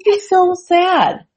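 overdone tearful pity; mock sad wail. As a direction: drag it out like you're welling up. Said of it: "and I'm like".

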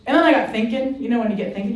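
ordinary talk

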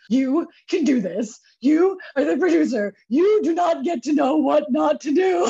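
high, loud, slow self-pep talk near tears; a scene from a movie, pumping herself up in the bathroom mirror. Retold or imitated. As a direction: psych yourself up in the mirror like a movie. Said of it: "stared at my face like a scene in a freaking movie".

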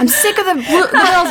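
high-pitched imitation of a fed-up coworker; laughing, high mock grumble; mock complaint. Imitating coworker sick of Bills cakes, high mock complaint.

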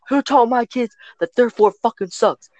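very high mock yell; shrieky pitch without real volume, mock fury; irate mother impression. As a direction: imitate an outraged mom demanding the culprit. Retold furious mother accuses them.

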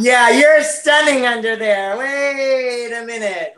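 drawn-out, marvelling double take; slow mock awe at a hidden beauty; slow amazed realization. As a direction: slowly let the penny drop. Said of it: "you're just like".

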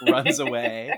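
ordinary talk, nothing marked

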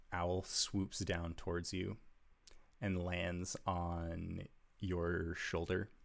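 normal talking voice

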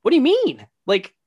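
high, loud disbelieving question; shocked kid. Retold or imitated. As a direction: gasp it out in disbelief. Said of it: "And I'm like".

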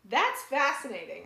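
high, loud burst of real excitement; fascinated. Real, not acted. Co-host reacting to research she just read out, excited. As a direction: exclaim it, genuinely fascinated.

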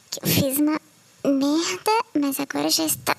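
ordinary delivery

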